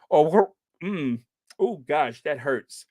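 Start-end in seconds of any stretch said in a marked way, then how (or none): 0.1-0.5 "wor-" with a strained trilled R; attempted rolled R. Shown as a demonstration. Host practicing until it hurts, "wor-" with rolled R. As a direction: force a roll on the R of "wor-". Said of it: "I'm trying to roll the R".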